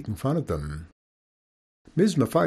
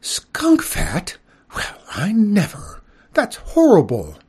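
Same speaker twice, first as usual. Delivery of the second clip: much higher, louder gasping horror; shocked outrage; 9 semitones higher, 6 dB louder, slower than usual. Acted. Skunk lady hearing of skunk fat medicine, shocked outrage. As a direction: recoil in scandalized disgust. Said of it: "She was upset".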